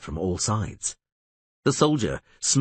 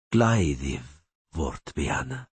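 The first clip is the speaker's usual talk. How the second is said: calm repeat; 5 semitones lower, slower than usual. A narrator's reading. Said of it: "repeated the rider".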